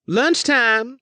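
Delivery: high, loud eager cry; gleeful mock threat. A narrator's reading. Elise scares the spy master, gleeful high cry. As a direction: announce the meal with relish.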